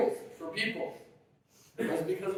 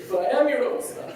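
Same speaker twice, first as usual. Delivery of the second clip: put-on higher, laughing retort of the child; the kid's indignant comeback, laughed through; 6 semitones higher, 3 dB louder than usual. Little boy answering his father's joke, higher and laughing. Boy's reply in the ritual.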